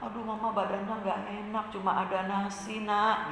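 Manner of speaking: very soft, weary mother's reply; hushed apologetic mama; weak, unwell mama. Acted. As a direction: answer the child faintly, as if poorly.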